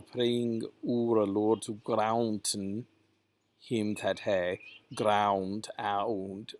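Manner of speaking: "grounten" slowly, as "grah-oon-ten"; two separate vowels. Shown as a demonstration. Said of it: "it's two vowels and it is two syllables".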